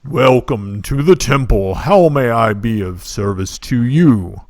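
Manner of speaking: loud, booming temple priest. Acted. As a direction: boom the welcome like a huge priest. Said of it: "in a booming voice".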